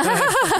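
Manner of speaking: sudden high laugh; a loud short laugh; burst of laughter. Real, not acted. Laughing at own joke.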